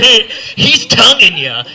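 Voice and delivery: slow, stretched out, laughing; slowly savoring the awkward phrase, half laughing; slow drawn-out. Shown as a demonstration. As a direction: drag it out awkwardly. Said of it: "trying to make this the least awkward".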